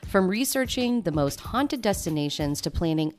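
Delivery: upbeat promo read; tourism ad. Acted. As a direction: sell the trip like a radio spot. Host reads travel agency ad.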